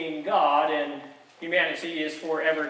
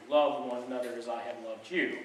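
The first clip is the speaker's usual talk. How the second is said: tender Jesus quote; 7 semitones lower, 8 dB quieter than usual. Acted.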